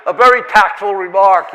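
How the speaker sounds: loud, high, through laughter; amused, wry comment while laughing; barely done laughing as you speak. Real, not acted. Laughs at the retort.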